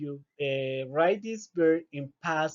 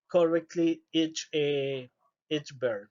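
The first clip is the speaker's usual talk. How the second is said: ordinary delivery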